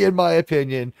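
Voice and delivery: ordinary delivery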